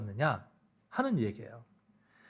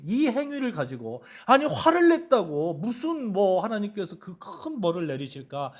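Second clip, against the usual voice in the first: usual speaking voice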